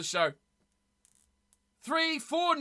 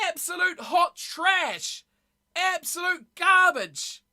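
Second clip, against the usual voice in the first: higher, louder, slow emphatic rant; slow ranting shout; 6 semitones higher, 4 dB louder, slower than usual. Real, not acted. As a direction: drag out each word in disgust. Ranting about the opening concert.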